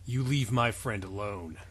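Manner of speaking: quiet, low threat from the shadows; low stranger voice. Acted.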